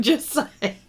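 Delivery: ordinary talk, nothing marked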